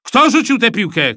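loud, high angry demand; shouting. A narrator's reading. Supervisor demanding who threw the ball, shouting. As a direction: shout to find the culprit. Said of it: "krzyknął Rosół".

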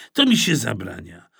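plain reading voice

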